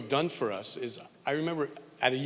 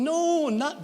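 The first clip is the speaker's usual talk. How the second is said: lightly voiced French-Canadian wife, pitched up; French accent; 14 semitones higher, 4 dB louder than usual. Husband imitating his French-Canadian wife correcting him. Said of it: "my wife has a French accent".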